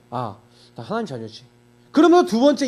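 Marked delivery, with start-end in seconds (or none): 0.1-1.4 quiet realization. Retold or imitated. Inner realization, quoted thought.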